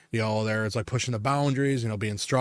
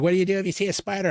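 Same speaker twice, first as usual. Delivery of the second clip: imitating actress; 7 semitones higher, much faster than usual. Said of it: "and she's like".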